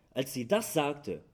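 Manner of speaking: ordinary delivery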